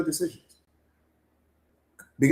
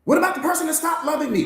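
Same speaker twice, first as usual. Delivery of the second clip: higher, complaining voice of a wounded student; hurt student; 8 semitones higher than usual. Acted. Coach voicing a student who was left, higher and complaining. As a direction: plead your hurt to the coach.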